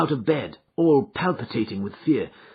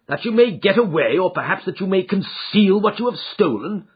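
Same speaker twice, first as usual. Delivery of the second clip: suspicious retort; 6 semitones higher, 4 dB louder than usual. Acted. Father suspects son's request.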